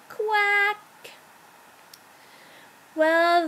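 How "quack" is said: high, drawn-out duck quack; storybook duck noise; puppy quacking. A narrator's reading. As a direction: quack instead of barking, pitched up.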